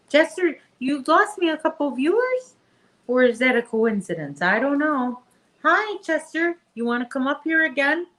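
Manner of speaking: high, playful scolding of the dog; teasing pet voice. Real, not acted. Owner teasing her dog Chester for losing viewers, high playful voice.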